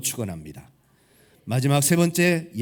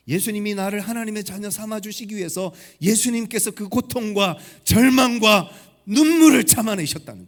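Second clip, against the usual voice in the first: high-pitched sermon climax, swelling to a loud cry; impassioned preaching; 7 semitones higher, 6 dB louder than usual. Real, not acted. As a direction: build fervently to a loud, emphatic peak. Preacher climaxes on Christ's suffering. (높은 음으로 점점 격앙되는 뜨거운 설교)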